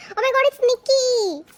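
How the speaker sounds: high, loud squeal falling at the end; real overjoyed surprise; delighted shriek. Real, not acted. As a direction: squeal as the favourite is revealed. Favourite member's card revealed.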